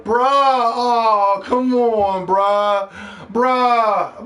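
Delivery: high, loud, drawn-out mock complaint; mock fanboy whining. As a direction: parody upset fans wailing "bruh", stretched out. Imitates fanboys complaining about troll.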